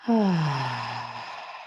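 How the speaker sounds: long, slow exhaled sigh; releasing sigh. Shown as a demonstration. Yoga teacher showing a deep exhale, releasing sigh. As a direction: let out a soft, releasing breath. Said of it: "just a nice releasing".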